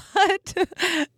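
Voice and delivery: high giggle breaking out; helpless snicker; real laugh. Real, not acted. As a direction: crack up at your own gross story. Laughs at disgusting story.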